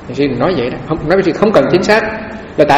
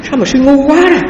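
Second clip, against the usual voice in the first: much higher, sharp mock scolding; startling rebuke; 11 semitones higher than usual. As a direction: re-enact the master's jolting rebuke. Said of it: "giật mình". (thầy thiền sư quát sư, giọng cao vút nhại lại)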